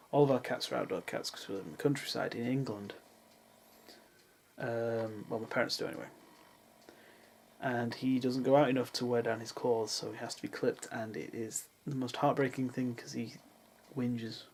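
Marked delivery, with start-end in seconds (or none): none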